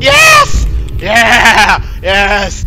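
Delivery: loud, excited shout of triumph; elated cheer. Real, not acted. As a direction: shout it out in delight. Finds wanted matches in game.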